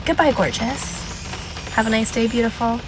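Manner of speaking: kill them with fake sweetness; softly sweet, dripping with insincerity; fake nice. Shown as a demonstration. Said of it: "to be fake nice".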